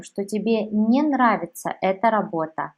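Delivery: usual speaking voice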